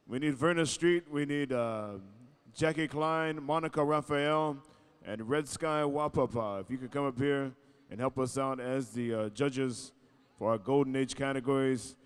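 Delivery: slow, drawn-out PA call; arena announcer. Acted. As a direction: summon the Golden Age dancers to the floor. MC calling judges.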